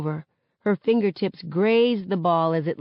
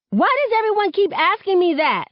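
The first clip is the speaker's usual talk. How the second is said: high, loud angry snap; snapping; 13 semitones higher, 5 dB louder than usual. A narrator's reading. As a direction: lash out at the question. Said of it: "I snap".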